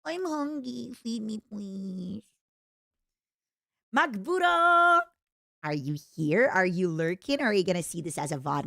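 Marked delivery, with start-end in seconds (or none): 0.0-2.2 put-on slow, whiny baby voice begging for food. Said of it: "my goddamn whimsy says". 3.9-5.0 excited call. Real, not acted. Calling out to viewer.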